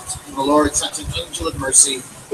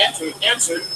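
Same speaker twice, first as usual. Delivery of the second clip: usual speaking voice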